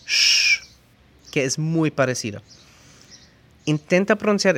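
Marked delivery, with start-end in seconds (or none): 0.1-0.6 a loud high hissed sh; sh as in shopping. Shown as a demonstration. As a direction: blow out a sharp sh. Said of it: "como en shopping".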